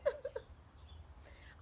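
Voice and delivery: high, breathy mock sobbing; soft, high crying sound from a hurt bird; bird sob. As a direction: let out a little pretend bird sob. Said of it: "And he's still crying".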